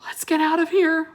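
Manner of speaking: ordinary delivery, nothing marked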